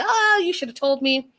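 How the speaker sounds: high, indignant complaint; imitating a betrayed husband, pitched high; mock hurt outburst. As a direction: whine it like he was betrayed. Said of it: "he feels that he's being double-crossed".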